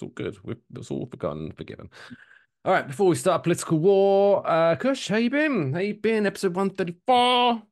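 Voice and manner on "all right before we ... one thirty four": high, sung-out host announcement; announcer segment opener. Acted. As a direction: call it out high like a showman. Host theatrically opens the catch-up segment.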